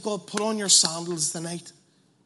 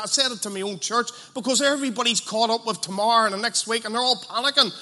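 normal talking voice

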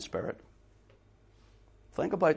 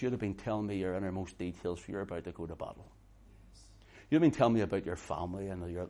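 soft, confiding, lower and quieter; hushed and intimate; 5 semitones lower, 8 dB quieter than usual. Acted. Dresser speaking softly to the soldier, hushed and intimate. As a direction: lean in close like a friend before battle.

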